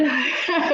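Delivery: short rising giggle; a quick real laugh at her own joke; light laugh. Real, not acted. Laugh mid-conversation.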